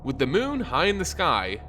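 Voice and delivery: ordinary delivery, nothing marked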